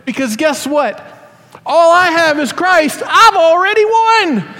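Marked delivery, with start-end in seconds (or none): 1.6-4.5 high, loud, slow proclamation; preacher's rising shout of victory; triumphant declaration. Real, not acted. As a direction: soar on it, full conviction. Sermon climax declaration.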